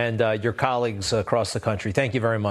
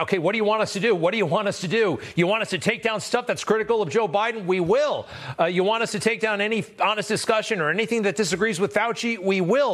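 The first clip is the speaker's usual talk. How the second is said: higher, hurried-to-please impersonation of a panicked company; mock freaked-out compliance; 7 semitones higher than usual. Host mimics Facebook caving to pressure from the President, mock freaked-out. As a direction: act out a caricature of panicked eagerness to obey. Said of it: "freaked out, bent over".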